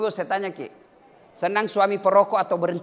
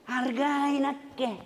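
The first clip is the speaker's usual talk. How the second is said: mock boast; 8 semitones higher, 3 dB louder, slower than usual. Mocking boastful politician, laughing.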